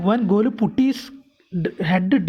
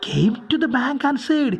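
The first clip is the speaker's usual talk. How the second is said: ordinary delivery, nothing marked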